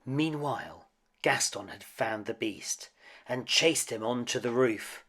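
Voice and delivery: ordinary delivery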